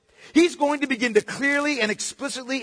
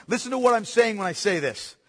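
ordinary talk, nothing marked

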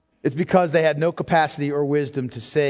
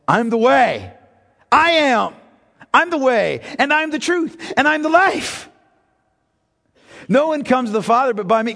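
higher, louder declaration; loud preaching; 5 semitones higher, 4 dB louder than usual. Acted. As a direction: proclaim each "I'm" with force. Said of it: "Jesus eliminates all of his fears by saying".

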